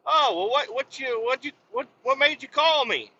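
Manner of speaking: high-pitched, stammering question; hammed-up curious caller; acted phone-call voice. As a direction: ask it high, tripping over the start. Acted phone call with old friend.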